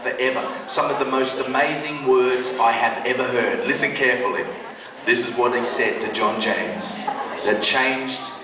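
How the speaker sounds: preacher telling the audience to listen carefully; sermon build-up. Acted.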